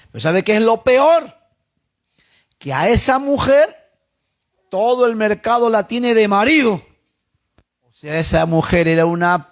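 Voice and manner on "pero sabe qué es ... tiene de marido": higher-pitched retold outrage; mock indignation. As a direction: imitate a scandalized wife revealing the worst. Acted betrayed wife exposing her rival.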